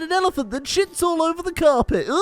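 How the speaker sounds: high-pitched, giggly mocking voice; squeaky, over-eager collaborator with silly giggles; hammed-up high voice, chuckling between ideas; Feldo. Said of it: "That's my impression of Feldo".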